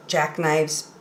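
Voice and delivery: ordinary delivery, nothing marked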